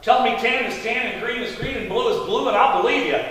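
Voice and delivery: normal talking voice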